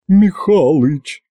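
slow, drawn-out cry with a breaking voice. A narrator's reading. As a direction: let the name crack. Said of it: "Голос Ягуты надорвался". (голос надрывается)

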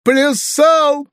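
very high, loud tearful bawl; sobbing wail. A narrator's reading. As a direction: bawl it out like a weeping boy. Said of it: "проревел Фалалей, всхлипывая". (громкий плаксивый рёв)

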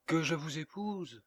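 incredulous mockery. A narrator's reading. Woman laughs off a marriage offer, incredulous mockery. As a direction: lift the question in amused disbelief.

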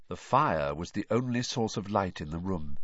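ordinary delivery, nothing marked

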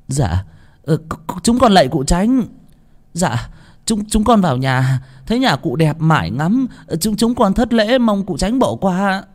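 high, stammering grovel; frightened pleading. A narrator's reading. Laborers stammering apologies to the master, high and fearful. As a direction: beg forgiveness nervously.

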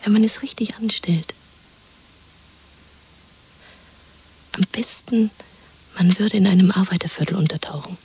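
conspiratorial whisper. Acted. Woman plotting escape with her lover: whisper. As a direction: plot it under your breath.

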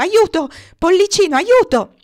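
high, loud panicked shriek; a gander screaming for rescue; shrieking for help. Acted. (strilla aiuto, acuto e forte, in preda al panico)

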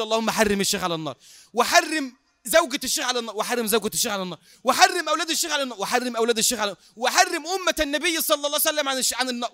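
acted prayer, surging at each new line; fervent supplication. [name] echoing the sheikh's dictated prayer, escalating and fervent.

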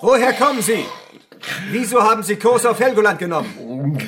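fierce interrogation shout; harsh interrogation. Acted. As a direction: bark the questions at the prisoner. Interrogator presses prisoner.

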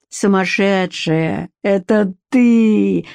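slow, mocking, cackling taunt; gloating laugh. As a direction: laugh at her spitefully. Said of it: "злорадно захохотала Черткова".

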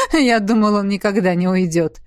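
relieved words after a laugh. A narrator's reading. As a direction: start with a chuckle, then settle. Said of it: "рассмеялся дракон". (со смехом)

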